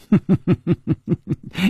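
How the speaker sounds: high, quick staccato chuckle; light laugh. A narrator's reading. Chu Liuxiang amused by Yinji's reaction, light laugh. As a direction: chuckle lightly before replying. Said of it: "楚留香笑了笑说".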